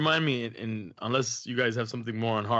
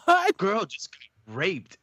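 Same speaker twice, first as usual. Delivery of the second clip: slow, higher mock shocked girl; imitating daughter; 9 semitones higher, 5 dB louder, much slower than usual. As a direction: stretch it out, a kid telling on the TV. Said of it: "She's like".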